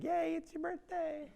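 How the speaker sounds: high, slow mock cheer; cheery coworkers. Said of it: "everyone's like".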